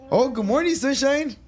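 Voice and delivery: high, loud sing-song good morning; mock cheery greeting.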